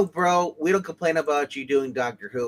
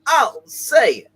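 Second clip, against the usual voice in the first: higher, louder put-on guy voice; Henry Jermick voice; 6 semitones higher, 5 dB louder, much slower than usual. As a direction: bark it out in a raised goofy voice. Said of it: "That's Henry Jermick voice".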